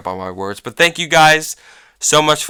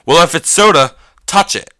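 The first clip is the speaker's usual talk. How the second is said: raised, higher, louder mock manager; louder, higher retold challenge to the kid; challenging dare; 6 semitones higher, 7 dB louder than usual. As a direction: call his bluff, loud and sharp. Manager calls the kid's bluff.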